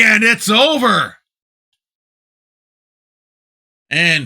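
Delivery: loud, excited play-by-play call; wrestling commentator. Acted. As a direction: call the match like a ringside commentator. Calls the match play-by-play.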